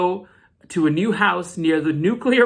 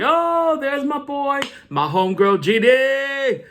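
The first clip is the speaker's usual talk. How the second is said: hammed-up joy at seeing old friends; mock excited reunion; 7 semitones higher, slower than usual. Mock happy reunion greeting.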